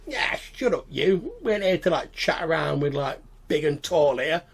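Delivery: scolding goblin boss. Acted. Goblin boss telling a crewmate to stop chatting, scolding. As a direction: cut your crewmate off crossly.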